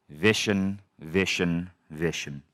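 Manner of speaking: grave mentor impression, fading down; deep voice. Mentor about to counsel his protégé, hushed deep voice. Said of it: "this really, really deep voice".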